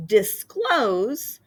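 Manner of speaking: disclose with a stretched CLOSE; dis-CLOSE, second syllable stressed. Shown as a demonstration. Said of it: "second syllable is highlighted".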